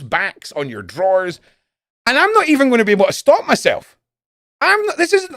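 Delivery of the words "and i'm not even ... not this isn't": excited rant. Real, not acted. Excited rant about snooping.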